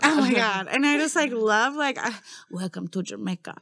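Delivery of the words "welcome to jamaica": bad Jamaican accent. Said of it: "I can't even do the accent".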